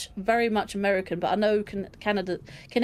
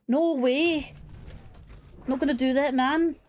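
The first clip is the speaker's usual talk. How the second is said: higher-pitched imitation Scouse; Scouse accent; 4 semitones higher than usual. Shown as a demonstration. Showing how a Scouse accent differs from London, in imitation Scouse. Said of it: "like, a Scouse accent".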